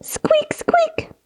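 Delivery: very high whispered squeaks; tiny breathy high squeaks; mouse squeaks. Shown as a demonstration. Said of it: "Take a deep breath in and say".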